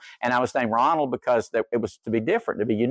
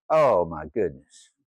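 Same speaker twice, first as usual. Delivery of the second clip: amazed dismay; low, slow oh my goodness; 7 semitones lower, slower than usual. Real, not acted. Pastor recalling the question, low amazed sigh. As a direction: drop low and draw it out.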